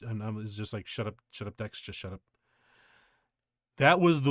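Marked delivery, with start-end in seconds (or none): 0.9-2.2 much softer and lower, scolding himself under his breath; muttering to self. Retold or imitated. Nervous boyfriend silently telling himself to stop talking, soft mutter. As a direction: hush yourself inwardly, barely voiced.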